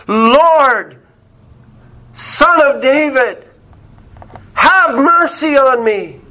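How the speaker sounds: high, pleading cry to the Lord; crying out loudly. Retold or imitated. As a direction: imitate a blind beggar calling out desperately. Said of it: "at the top of his lungs".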